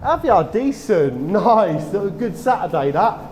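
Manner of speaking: genuinely impressed by the catch; excited chat. Real, not acted. Dog walker asking an angler about his catch, impressed and excited.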